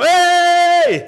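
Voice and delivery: long, high, loud "hey"; father's shout. Acted. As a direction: shout to break through a child's panic. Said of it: "Hasta que me dijo". (grito largo para hacerlo reaccionar)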